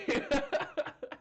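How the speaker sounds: high giggle at own joke; real laugh. Real, not acted. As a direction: break into laughter after the bit. Laughs at own mock apology.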